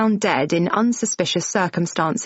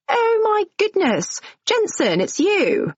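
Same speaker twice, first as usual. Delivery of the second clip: much higher, gushing mock delight; gushing surprise; 13 semitones higher than usual. Jane greeting Jensen as an old friend, gushing and high. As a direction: fake an overjoyed recognition.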